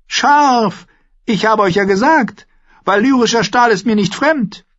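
high, loud boasting; high-pitched gloating royal shout; triumphant boy king. A narrator's reading. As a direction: crow over the cut in a high voice. King shows off cutting sword.

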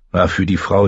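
usual speaking voice

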